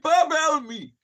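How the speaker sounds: high, loud mock cry for help; acted panic. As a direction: re-enact a panicked plea, rising in volume. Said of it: "I'm hyperventilating".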